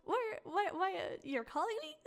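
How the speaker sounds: high, baffled phone pickup; confused surprise. Retold or imitated. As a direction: sound totally lost on the line. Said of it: "And they're like".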